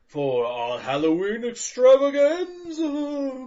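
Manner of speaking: slow, high, drawn-out announcer. Acted. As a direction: hype it like a showman. Announcer hype.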